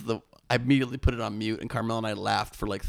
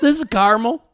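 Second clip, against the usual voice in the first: much higher, louder Southern woman's voice; bad Southern accent; 11 dB louder than usual. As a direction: put on a hammed-up Southern belle. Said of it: "bad Southern accent".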